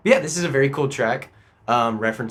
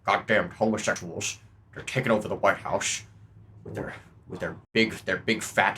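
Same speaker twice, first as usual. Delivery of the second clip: put-on low-key muttering old politician, softer; mock presidential grumble; 3 semitones lower, 6 dB quieter than usual. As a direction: grumble it quietly to yourself.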